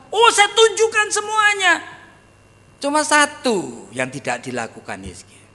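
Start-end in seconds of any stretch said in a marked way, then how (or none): 0.1-1.7 high, pleased voice of a king showing off; proud boasting king. Retold or imitated. Preacher voicing the boastful king Hezekiah answering Isaiah, high and proud.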